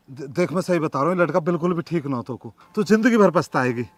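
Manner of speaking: hushed, fast, low warning; quiet earnest plea; quiet pleading. Acted. Aman pleading softly with girl.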